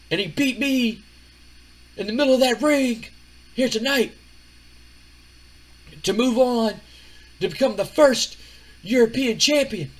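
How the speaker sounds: loud, high-pitched wrestling promo delivery; Scott Steiner promo. Acted. Wrestler addresses his freaks after losing, loud boastful promo. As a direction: project it big and high, pausing between phrases. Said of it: "he cuts a little promo".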